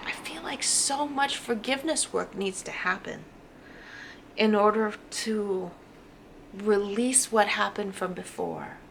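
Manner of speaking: usual speaking voice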